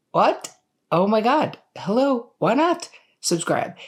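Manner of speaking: high-pitched, playful incredulity; playfully scandalised at the audience; mock disbelief. Mock shock at unsubscribed viewers.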